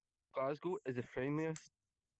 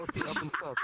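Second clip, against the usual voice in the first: high and louder, half-panicked; retold realization; 14 semitones higher, 5 dB louder than usual. Retelling the moment he realized it, high panicked realization. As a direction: pitch it up as it dawns on you.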